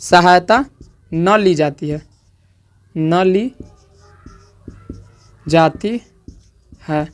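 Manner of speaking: slow, chopped into single words with long gaps; dictating slowly. Shown as a demonstration. Teacher dictating the definition to students, word by word. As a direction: let each word land before the next. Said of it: "dictating definition to students".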